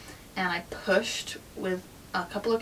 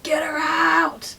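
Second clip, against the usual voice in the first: loud, slow, desperate yell; screaming; 8 semitones higher, 9 dB louder, much slower than usual. Retold or imitated. Woman in labour screaming at her partner, higher and loud. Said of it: "I was screaming at Gary".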